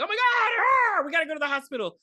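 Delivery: high, loud panicked shout; hammed-up frightened dad; mock panic. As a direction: yell in alarm after the bite.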